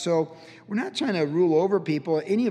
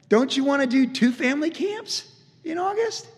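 higher and louder, hammed-up enthusiasm; mock eager pastor; 10 semitones higher, 5 dB louder than usual. Pastor urging staff to work Sundays, mock eager and louder. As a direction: lightly imitate a pastor cheerfully selling extra work. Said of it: "Pastor Chuck expected people to be on staff".

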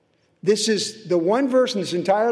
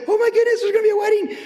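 much higher and louder, thrilled; excited gasp; 4 dB louder, much faster than usual. Retold or imitated. Preacher voicing villagers thrilled about a wedding, high and excited. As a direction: squeal like delighted villagers.

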